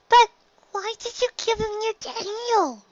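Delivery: small filly, puzzled and hesitant; curious filly. Acted. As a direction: ask shyly like a young girl. Filly asks.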